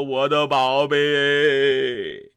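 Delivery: 低沉拖长的哭喊，很慢; 压低声音慢慢哭诉宝贝. Acted. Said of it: "这俩都哭了". (广成子心疼宝贝，低声拖长哭腔)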